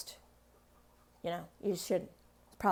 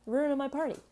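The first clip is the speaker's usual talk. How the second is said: mock Claudius; 6 semitones higher than usual. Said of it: "Claudius is like".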